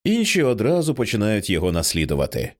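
plain reading voice

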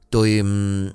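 hesitant start, trailing into a drawn-out "ừm". Acted. Husband unsure how to tell his wife, hesitant. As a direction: stall on a long, level "ừm". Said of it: "Cũng phải chần chừ thêm một lúc".

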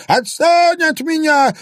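high, loud, slow crone wail; wailing yell. A narrator's reading. Old woman wails at the man gripping her, high and loud. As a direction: howl at him to let go. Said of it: "продолжала голосить старуха".

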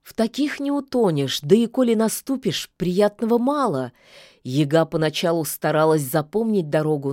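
usual speaking voice

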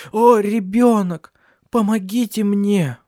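high, loud, slow cry of pain; desperate cry. A narrator's reading. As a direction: cry out in fear for the baby. Labour pains, crying for help.

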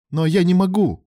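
indignant burst of anger; flaring up. A narrator's reading. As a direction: blurt it out in a hot temper. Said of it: "вспылил Алан".

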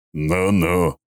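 amused, cocky smirk. A narrator's reading. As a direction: say it with a smug grin. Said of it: "усмехнулся он".

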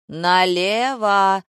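long, loud drawn-out shout. A narrator's reading. Director ordering the girl to move, shouting. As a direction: shout it slowly, stretching the vowels. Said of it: "закричал режиссёр".

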